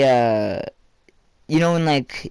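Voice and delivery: usual speaking voice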